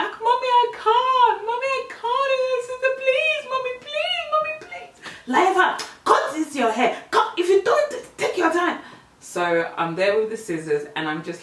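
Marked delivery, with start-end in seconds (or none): 0.0-4.9 hammed-up, very high wailing plea; squeaky imitation of tearful begging; mock child pleading. Acted child begging her mum. 5.3-8.8 loud, high scolding orders; exaggerated threatening mum voice; mock angry mum. Acted mum ordering her child.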